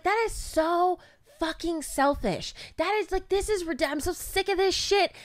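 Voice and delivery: mock fed-up complaint; exasperated rant. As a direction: pour out the frustration. Retelling her rant in car.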